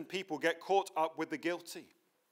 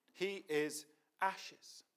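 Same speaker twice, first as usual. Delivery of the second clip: slow emphasis; 2 semitones higher, 5 dB quieter, much slower than usual. Real, not acted. Preacher slows for emphasis.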